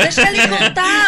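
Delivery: loud, high and laughing, slowed down; laughing protest. Real, not acted. Wife laughing, tells host to let husband tell it. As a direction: laugh it out while defending him.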